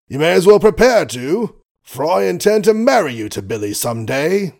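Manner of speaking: put-on blustering old colonel voice; gruff old colonel. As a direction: play the grumpy doting father.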